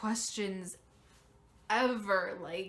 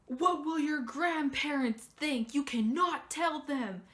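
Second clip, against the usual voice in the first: imitating mom, higher and louder; mock freaked-out mom; 5 semitones higher, 5 dB louder than usual. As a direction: play the mother panicking, raised up. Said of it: "And then she freaks out".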